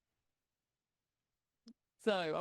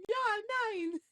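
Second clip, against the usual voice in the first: high, mock German yeah and nine; a hammed-up German yes and no; laughing mock German bluff; 9 semitones higher than usual. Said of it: "This Billy just going".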